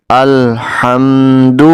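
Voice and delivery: slow, drawn-out recitation of الحمد; Quranic recitation. Shown as a demonstration. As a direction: recite الحمد with measured tajweed. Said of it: "دو پڑھنا".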